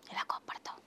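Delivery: a soft playful whisper. Shown as a demonstration. Father whispers to the little girl how she gets angry. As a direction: breathe the question with no voice.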